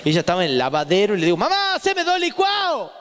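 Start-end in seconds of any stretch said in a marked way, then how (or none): 1.4-2.9 very high, drawn-out holler; calling out to mom. Retold or imitated. Boy orders milkshakes from mother in the laundry, calling out high. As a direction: holler slowly to mother in another room. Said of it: "Yo tenía un externo que era mi voz".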